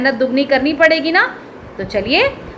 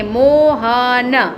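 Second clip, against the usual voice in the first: very slow stretched reading; slow demo of Mohan; slow drawn-out word; much slower than usual. Shown as a demonstration. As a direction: draw the word out for the kids. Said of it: "तो कैसे लिखेंगे".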